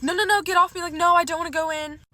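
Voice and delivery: high, loud retold pleading; frantic protest. Date dragging her into the quarry, frantic protest. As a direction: act out struggling to get free, loud and high. Retold fight at water's edge.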